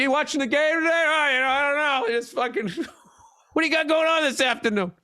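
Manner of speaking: high, fast chatty stranger voice; stranger small talk. Retold or imitated. Mocking shower talk with his wife, high chatty stranger. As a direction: play a bland locker room stranger. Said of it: "Just some other stranger".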